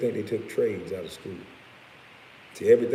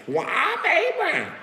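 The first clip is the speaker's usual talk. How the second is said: very high, drawn-out silly squeal; mock squeal; 6 dB louder than usual. Streamer giving his Cash App name, mocks the wrong version in squealing falsetto. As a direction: stretch the name out in a loud falsetto.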